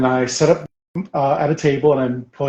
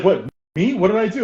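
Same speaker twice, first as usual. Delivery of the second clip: higher-pitched baffled protest; acted innocent bewilderment; 7 semitones higher than usual. Accused gamer answering a new acquaintance, acted bewildered innocence. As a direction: mimic someone wrongly accused. Accused friend voiced in story.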